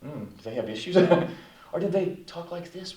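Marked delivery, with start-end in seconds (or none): 1.7-3.0 small, soft, timid voice; hushed, fearful tone demo; acted afraid. Shown as a demonstration. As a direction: shrink back as if demonstrating fear. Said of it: "Were they afraid?".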